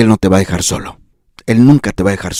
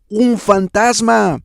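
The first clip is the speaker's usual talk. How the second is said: high, slow, hushed gasp of fear; startled ghost sighting, drawn out; frightened cry; 10 semitones higher, 3 dB quieter, slower than usual. Acted. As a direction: breathe it out like seeing a ghost. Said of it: "dieron voces de miedo".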